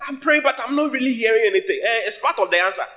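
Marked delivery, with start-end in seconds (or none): 0.1-1.8 high-pitched mock complaint; mock plaintive objection; complaining believer. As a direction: imitate a puzzled believer's protest, pitched up.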